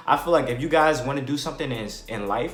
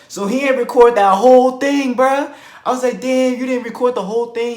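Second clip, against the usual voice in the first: much higher and louder, exasperated; worked-up complaint; 11 semitones higher, 10 dB louder than usual. Retold or imitated. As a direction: complain loudly, fired up. Frustrated at friend's missing video.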